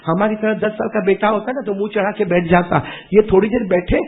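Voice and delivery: normal talking voice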